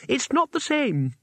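unruffled by the panic; calm. A narrator's reading. Two Flower answering the shouting Rincewind, high and calm. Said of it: "said Two Flower calmly".

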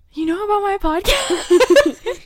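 gasping, slow and delighted; surprised. Retold or imitated. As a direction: act amazed he knows. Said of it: "And I was like".

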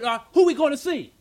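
high, loud retold question; puzzled partner. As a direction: imitate a partner demanding to know. Impatient partner questioning him, acted.

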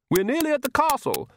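high-pitched countess rallying the family; high, encouraging mother's voice. A narrator's reading. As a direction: pitch it right up to cheer them on. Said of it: "trying to rally them".

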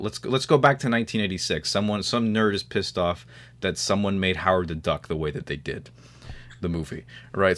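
usual speaking voice

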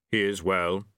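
unhurried Death, every word weighed; grim reaper voice. A narrator's reading.

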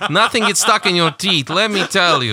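high mock Ukrainian accent with laughter; hammed-up Eastern European pitch, giggling. Mock Ukrainian seller praising a lady. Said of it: "laughing at the Ukrainian accent".

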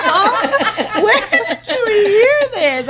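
high, drawn-out laughing shriek of disbelief; retold shock, cracking up. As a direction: squeal it through laughter, stretched out. Reacting to child's joke, laughing.

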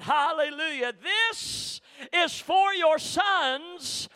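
preaching at full force. Real, not acted. Pastor testifying to the congregation about his daughter, shouting in full preaching. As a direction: testify to the church with rising fire.

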